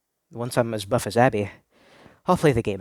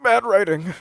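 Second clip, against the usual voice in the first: higher, throat-clearing mock pundit; pompous gamer verdict; 8 semitones higher than usual. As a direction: clear your throat and pronounce judgment. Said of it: "one guy said".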